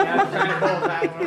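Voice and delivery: laughing while confessing. Real, not acted. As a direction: giggle through the words. Caught cheating at cards.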